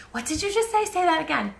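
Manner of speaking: high, puzzled voice imitating people who catch her accent; a mock-baffled listener asking her to repeat; mock puzzled stranger. Imitating people who call out her Boston accent, puzzled. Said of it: "They're like".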